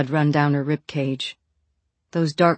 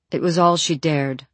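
plain reading voice

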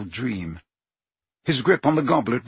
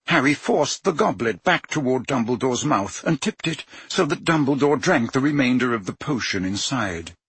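plain reading voice

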